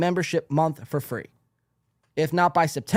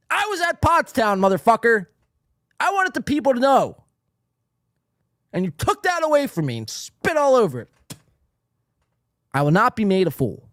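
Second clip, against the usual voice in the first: high, loud hammed-up fury; joking outburst about the Pottstown poster; mock rage; 6 semitones higher, 5 dB louder than usual. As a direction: yell it like you were robbed of glory. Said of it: "Getting a little worked up now".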